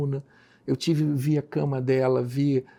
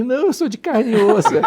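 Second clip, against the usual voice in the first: laughing; 10 semitones higher, 8 dB louder than usual. Retold or imitated. Laughing at being called angel.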